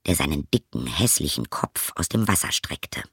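ordinary delivery, nothing marked

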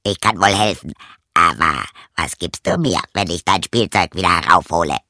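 croaky-voiced frog calling up from the well; narrator's frog voice, punchy and forward; storybook frog; frog character. A narrator's reading. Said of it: "antwortete der Frosch".

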